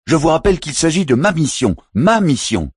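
high furious shout, stressing ma; roaring. A narrator's reading. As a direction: roar it, insisting it's his mission. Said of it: "rugit Philpas Moussa".

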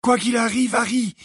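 high, forceful character voice; Neville, fierce. A narrator's reading. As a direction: urge a friend with ferocity. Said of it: "dit Neville d'un ton féroce". (ton féroce, voix aiguë)

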